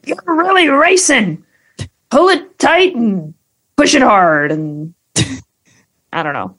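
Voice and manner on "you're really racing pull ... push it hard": high, hyped ride announcer car. Retold or imitated. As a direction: pump up the rider like a talking car. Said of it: "they'll go like".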